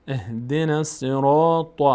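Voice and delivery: "الصراط" with a dark, rounded "ro"; full heavy R. Shown as a demonstration. Teacher shows the letter read full, slow heavy-R recitation. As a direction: chant slowly, filling out the ر of "الصراط".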